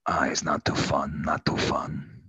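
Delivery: low, soft, slow pirate voice; hushed, deep pirate ghost; subdued pirate character. Acted. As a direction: grumble it quietly like an old pirate.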